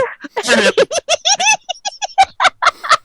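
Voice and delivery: long high giggle fading down; high laugh. Real, not acted. Laughs at punchline.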